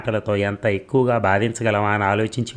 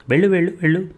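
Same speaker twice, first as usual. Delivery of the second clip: raised, rapid yell; angry yell; 9 semitones higher, 3 dB louder, much faster than usual. A narrator's reading. Husband yelling at his wife to go, high and fast. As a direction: fire it out fast and high-pitched. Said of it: "అరిచాడు".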